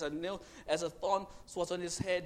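ordinary talk

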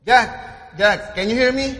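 high, searching call; voice from above. Acted. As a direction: call down to someone far below. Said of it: "when he heard a voice".

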